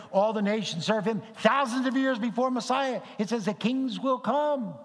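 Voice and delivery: high, driving pulpit delivery; emphatic preaching. Real, not acted. Preacher telling congregation of Old Covenant prophecy, emphatic preaching. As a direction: proclaim it with rising excitement.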